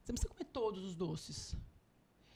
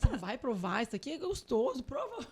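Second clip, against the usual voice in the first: pushy offering; 3 semitones higher, 8 dB louder than usual. Retold or imitated. Mother pushing friend to try.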